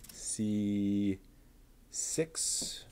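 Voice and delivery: "C" then "six" said apart, slowly and carefully; careful pronunciation. Shown as a demonstration. Builder checking the part label, careful pronunciation. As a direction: spell out "C" and "six" one at a time with care. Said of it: "I try to pronounce things correctly".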